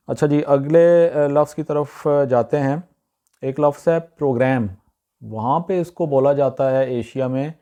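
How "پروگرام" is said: "پروگرام" as PRO-gram, "a" as in cat; flat "a" ending in "پروگرام"; correct pronunciation. Shown as a demonstration. Teacher introduces the word program, correct pronunciation. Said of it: "اس کا صحیح جو تلفظ ہے".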